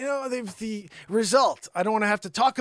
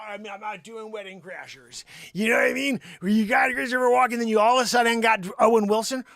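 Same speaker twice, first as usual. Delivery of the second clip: ordinary talk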